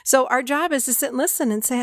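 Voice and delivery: ordinary talk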